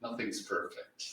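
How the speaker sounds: usual speaking voice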